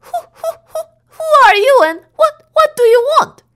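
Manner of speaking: high, shaky, stammering in fear; trembling voice. A narrator's reading. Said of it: "In a trembling voice".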